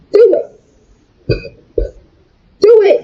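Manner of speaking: mock yell of "Do it!", high and pushed; retold yell. Daring her mom to call the police, in a retold yell. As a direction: shout the dare at her mother again. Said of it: "I was screaming around".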